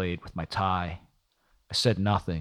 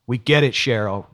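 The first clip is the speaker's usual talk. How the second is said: louder, fed-up retort; anger boiling over; 4 semitones higher, 6 dB louder than usual. A narrator's reading. Detective fed up with Cheryl's apologies, anger boiling over. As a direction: cut her off with restrained anger. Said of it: "his anger boiling over".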